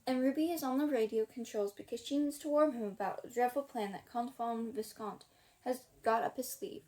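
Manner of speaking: ordinary delivery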